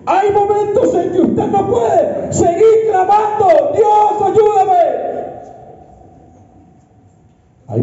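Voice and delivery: high, slow, shouted exhortation; rally the crowd, drive every word; full-force preaching. Acted. Pastor urging the congregation to march, shouting high.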